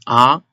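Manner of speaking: "a" sliding up like a question; rising second tone. Shown as a demonstration. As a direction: say "a" as if asking. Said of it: "It sounds like a question".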